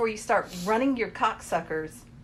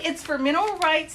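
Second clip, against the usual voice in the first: ordinary delivery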